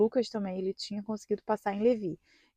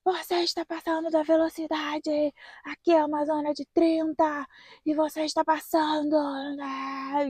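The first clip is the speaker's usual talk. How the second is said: mock examiner yell; 6 semitones higher than usual. Examiner scolding the student driver for speeding, high mock yell. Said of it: "o cara deu um berro assim comigo".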